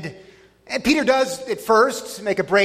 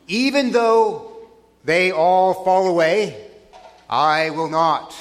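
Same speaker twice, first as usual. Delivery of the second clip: slow, louder, emphatic declaration; adamant Peter; 3 dB louder, slower than usual. Acted. Peter contradicting Jesus, adamant. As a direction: declare it firmly, word by word.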